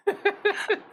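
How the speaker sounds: high burst of laughter; big laugh. Real, not acted. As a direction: crack up at the joke. Laughing at NDA joke.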